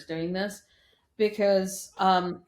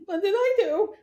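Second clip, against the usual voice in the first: much higher, louder startled question; startled son; 13 semitones higher, 5 dB louder than usual. Retold or imitated. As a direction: act taken aback. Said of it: "I'm like".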